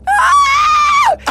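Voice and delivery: loud high shriek; Vicky screaming in shock. Acted. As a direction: scream as if shot. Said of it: "Vicky is like".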